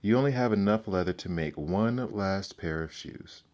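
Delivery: ordinary delivery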